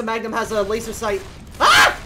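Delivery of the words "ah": high, loud shriek; startled scream. Real, not acted. Player caught by zombies, startled shriek. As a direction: shriek in sudden fright.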